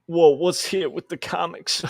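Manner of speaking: a silly whoa, hammed-up; goofy voice. Goofy voice again.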